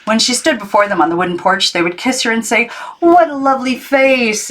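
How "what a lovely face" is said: put-on great aunts greeting the new girl, delighted; gushing aunts. A narrator's reading. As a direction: coo it like a doting great aunt. Imagined aunts greeting her.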